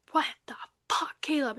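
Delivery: whispered retold shock; hushed disbelief. Confronting Caleb over her broken dresser, in a stunned whisper. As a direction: breathe it out softly, stunned.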